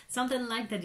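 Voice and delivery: ordinary talk, nothing marked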